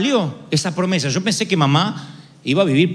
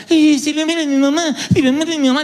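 higher, whimpering imitation of his own fear; mock the scared self, pleading; a mock whimper, half-hearted and pitiful; whiny self-pity; 7 semitones higher than usual. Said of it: "ahora estaba como".

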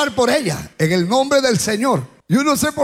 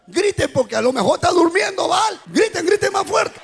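raised-pitch jeering encouragement; mocking taunt; 5 semitones higher than usual. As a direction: goad them mockingly, voice climbing. Elijah mocks the priests of Baal.

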